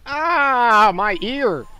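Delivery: sudden loud yelp of pain. Real, not acted. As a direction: cry out as if your ear hurts. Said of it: "Stupid wimp".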